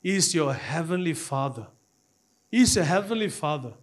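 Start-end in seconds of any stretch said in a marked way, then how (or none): none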